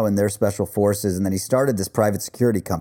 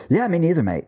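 put-on rough South African accent, pitched higher; 7 semitones higher than usual. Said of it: "I can't do the accent".

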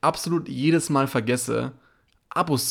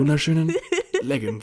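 mock ad voice; 4 semitones higher than usual. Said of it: "Du sprichst so".

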